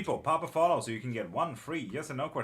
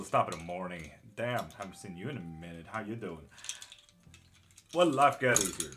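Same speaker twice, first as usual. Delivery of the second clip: ordinary talk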